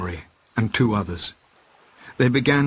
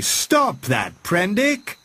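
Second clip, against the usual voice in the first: high sharp cry; 10 semitones higher, much slower than usual. A narrator's reading. Montgomery crying out to stop Prendick. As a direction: yell his name to stop him. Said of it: "cried Montgomery".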